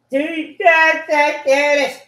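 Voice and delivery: loud, high-pitched burst; shouted outburst. Real, not acted. Speaker bursting out mid-monologue, shouting.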